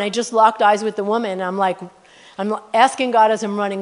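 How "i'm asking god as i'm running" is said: ordinary talk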